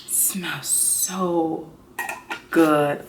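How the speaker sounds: breathy whisper of delight. Real, not acted. Vlogger whispering over the candle smell. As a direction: whisper it as if savoring the scent.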